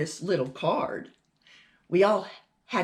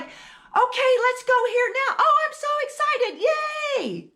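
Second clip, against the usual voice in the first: loud, high, excited shouting; gleeful, high-pitched yelling; outside voice; 5 dB louder than usual. Shown as a demonstration. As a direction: shriek like an overexcited kid. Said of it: "A lot of times we'd be like".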